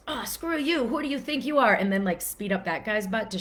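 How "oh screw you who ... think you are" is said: high, indignant jab at the other driver; hammed-up outrage at a reckless driver; mock road rage. Imitating a driver yelling at the guy who cut them off, mock angry.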